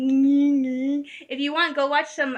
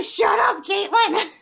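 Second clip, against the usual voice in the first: higher, louder mock scolding with a laugh; mom snapping; 6 semitones higher, 4 dB louder, slower than usual. Scared mom scolds her teasing daughter, mock snap. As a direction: imitate mom telling her to hush.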